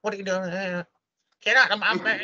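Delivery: high, put-on quavery singer voice; singer impression. Singer finds his wife with lover, high imitation voice. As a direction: do the singer confronting someone, pitched high. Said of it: "that's the best I could do".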